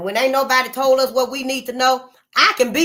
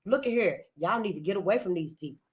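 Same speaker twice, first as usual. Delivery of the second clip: said softly, almost to herself; quiet warning; 7 dB quieter than usual. Real, not acted. Warning repeated twice.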